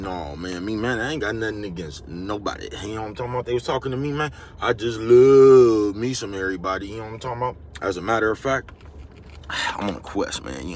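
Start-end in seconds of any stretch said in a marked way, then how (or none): 4.6-6.9 loud, slow declaration. Acted. To the viewers, declaring love for everybody, loud and slow. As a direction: belt it out slow and loud with a different voice.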